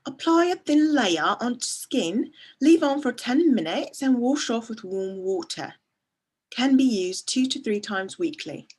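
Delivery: lightly put-on British accent. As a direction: read the directions in a British accent. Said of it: "Please read in a British accent".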